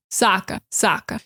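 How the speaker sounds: New York accent, wide open vowels. Shown as a demonstration. Teacher demoing a New York accent for learners. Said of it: "New York opens up wider and goes, "Ah".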